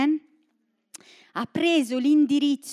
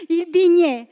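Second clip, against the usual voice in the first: laughing; 4 semitones higher, 4 dB louder than usual. Real, not acted. Laughing at his own mix-up.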